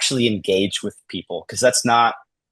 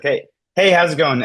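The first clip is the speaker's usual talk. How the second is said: higher and louder, bright hello; upbeat friendly greeting; 6 semitones higher, 5 dB louder than usual. Shown as a demonstration. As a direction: greet the cashier like an old friend. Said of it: "this is my go-to".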